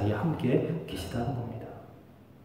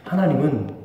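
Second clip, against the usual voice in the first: ordinary talk, nothing marked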